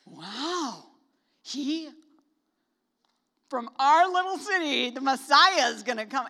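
loud, awed gushing; excited crowd, loud and amazed; thrilled townsfolk. Retold or imitated. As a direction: gush like proud neighbours. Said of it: "they were like".

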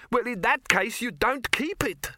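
very high, deliberate dwarf voice; expansive dwarf. A narrator's reading. Dwarf about to explain mining at length, expansive. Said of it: "in the expansive manner of one about to settle down".

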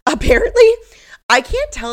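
high laugh spilling into the word. Real, not acted. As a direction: laugh through it, loud and high. Laughing mid-sentence.

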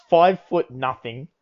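ordinary talk, nothing marked

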